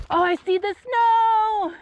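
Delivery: high, thrilled cry at spotting snow; excited discovery. Real, not acted. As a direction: squeal it out with delight.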